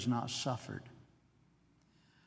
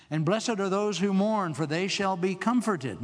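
loud, higher proclaimed verse; preacher reading the beatitude; scripture proclamation; 5 semitones higher, 5 dB louder than usual. Acted. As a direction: proclaim the blessing from the pulpit.

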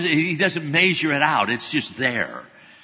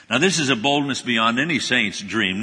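ordinary delivery

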